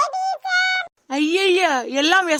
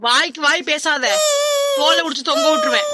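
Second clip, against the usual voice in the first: mock stern scolding; 8 semitones higher than usual. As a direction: scold the cheeky kids in a put-on tearful voice. Teacher scolding crying kids.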